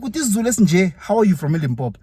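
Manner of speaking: can't believe what you're hearing; amazed disbelief. Retold or imitated. Teacher asking a Zulu-fluent father, in high loud disbelief.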